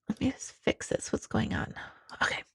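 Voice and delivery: whispered aside to herself; a quick unvoiced whisper, off-mic. Real, not acted. Muttered aside while fixing camera.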